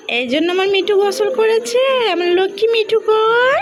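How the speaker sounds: normal talking voice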